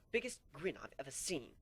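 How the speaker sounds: ordinary delivery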